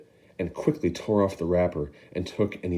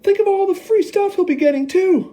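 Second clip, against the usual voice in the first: much higher, louder envious whine; enviously; 8 dB louder than usual. Acted. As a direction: resent his luck. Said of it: "another boy said enviously".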